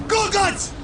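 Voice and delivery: high-pitched, outraged yell; yelling after someone. Acted. Warlord calls again after the deserting orc, outraged. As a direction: yell the name at a retreating back.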